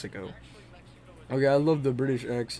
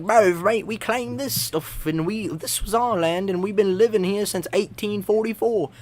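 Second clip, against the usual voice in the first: a light, higher-pitched mock British accent; 5 semitones higher than usual. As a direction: play the Bunker Boys in a hammed-up British accent. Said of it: "in the British accent".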